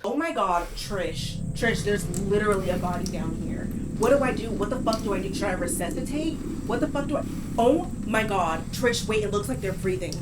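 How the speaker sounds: soft, urgent mock panic at the pipe; whisper-close, freaking out but keeping it down; hushed pretend panic. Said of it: "pretend you are blindfolded".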